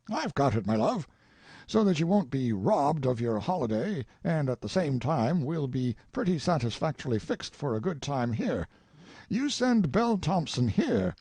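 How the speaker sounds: overflowing with a happy idea; delighted old father. A narrator's reading. Father telling Tracy about Belle, mildly delighted.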